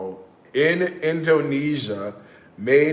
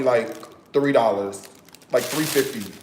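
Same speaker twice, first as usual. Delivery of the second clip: ordinary talk, nothing marked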